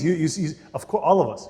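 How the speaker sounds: ordinary delivery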